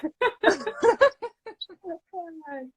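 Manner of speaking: high, giddy laugh; breathless laughter. Real, not acted. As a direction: laugh out the nerves. Overwhelmed laughter after greeting. (risada ofegante)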